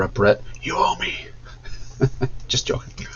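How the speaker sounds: soft unvoiced joking whisper with a laugh; teasing a band member in a playful whisper. Real, not acted. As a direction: whisper a mock threat, then laugh it off.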